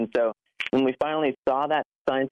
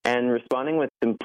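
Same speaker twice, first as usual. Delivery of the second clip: ordinary talk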